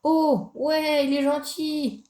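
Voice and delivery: very high, drawn-out happy squeal; delighted. Real, not acted. Gamer finding the dinosaur friendly, delighted squeal. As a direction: coo in high-pitched delight.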